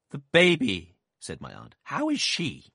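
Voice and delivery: loud, insistent question; stern aunt. A narrator's reading. Aunt pressing the doctor about the baby, insistent. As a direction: press him hard.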